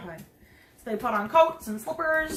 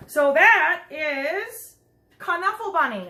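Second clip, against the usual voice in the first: much louder, slow buildup; grand announcement; 4 semitones higher, 7 dB louder, slower than usual. Real, not acted. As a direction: build up to the big title. Announcing the book title.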